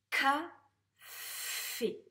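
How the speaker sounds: "Café" as loud, high ca, then long ffff-é; sounded-out reading. Shown as a demonstration. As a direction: blend "Café" slowly, holding the f. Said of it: "Allez, on corrige". (« Café » déchiffré fort, avec un f très allongé)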